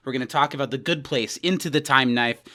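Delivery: usual speaking voice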